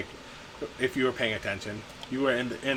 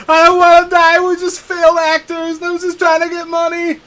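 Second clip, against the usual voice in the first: high, loud tearful pleading; begging for my life; 13 dB louder than usual. Acted. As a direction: wail it out, begging to live. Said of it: "starts pleading for his life".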